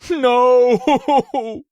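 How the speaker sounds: high, loud cry of grief; anguished cry. A narrator's reading. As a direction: cry out in despair. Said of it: "I cried out".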